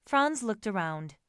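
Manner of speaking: usual speaking voice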